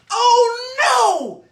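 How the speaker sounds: high, loud cry of dismay; a loud, high-pitched shocked cry. Real, not acted. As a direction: gasp it out in shock. Dismay at capture.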